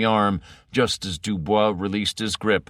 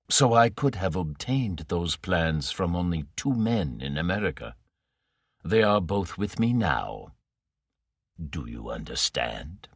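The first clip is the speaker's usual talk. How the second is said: Morrow, soft menace; 2 semitones lower, 4 dB quieter than usual. Acted. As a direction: purr it softly like a patient villain.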